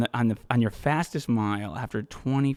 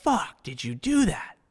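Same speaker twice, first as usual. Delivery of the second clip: amazed onlookers; 13 semitones higher than usual. Retold or imitated. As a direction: play stunned spectators. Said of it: "people are like".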